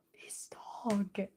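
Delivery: slow whispered denial; slow whisper insisting. Real, not acted. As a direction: hush it out slowly. Denying chat's joke.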